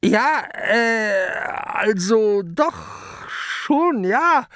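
high, slow hemming admission; cowed kraken stuttering; stammering kraken. A narrator's reading. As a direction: admit it sheepishly. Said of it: "stottert der Krake".

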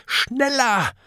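high-pitched urgent cry; high character yell; urgent shout. A narrator's reading. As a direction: shout it high to hurry the others on. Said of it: "schreit Kokosnuss".

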